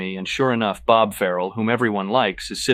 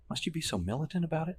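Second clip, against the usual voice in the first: quick; 8 semitones higher, 7 dB quieter, faster than usual. Acted. Evelyn quick dismissal.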